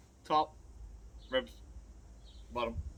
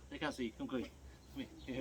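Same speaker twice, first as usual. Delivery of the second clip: quiet; 12 dB quieter, faster than usual. Real, not acted. Calling an animal over softly.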